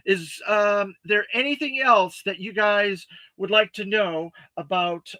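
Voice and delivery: ordinary talk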